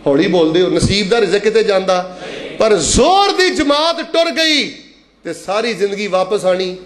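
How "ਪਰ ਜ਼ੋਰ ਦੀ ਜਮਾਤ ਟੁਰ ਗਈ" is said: high, slow preacher's cry; raised-pitch sermon delivery; impassioned preaching. Real, not acted. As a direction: draw it out high for the congregation.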